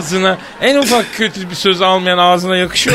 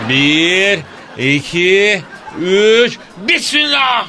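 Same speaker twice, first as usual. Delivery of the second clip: building countdown; 4 semitones higher, much slower than usual. Pretend. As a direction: count down, rising to a shouted launch. Counting down before pulling wax.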